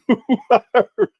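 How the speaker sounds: high laughing jab; laughing question. Real, not acted. Pressing Umar on who hired them, laughing. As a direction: ask it again through a laugh.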